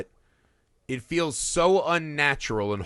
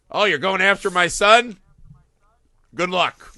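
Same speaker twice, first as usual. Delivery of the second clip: higher, louder, breezy brush-off; mock dismissive dad; 4 semitones higher, 6 dB louder than usual. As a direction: wave her off like an uncaring dad. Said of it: "the dad's like".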